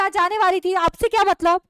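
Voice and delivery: high, loud sulky snap; angry retort. Acted. Angry daughter Doris loudly snaps back at the neighbour. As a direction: throw it back at her, loud. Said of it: "अब Doris को गुस्सा आ चुका है".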